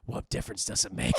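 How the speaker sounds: hushed, whispery acted comeback; whispered retort. Boyfriend arguing back under the table, whispered. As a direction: whisper it like a secret argument.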